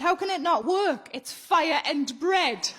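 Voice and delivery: slow. Retold or imitated. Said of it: "He said".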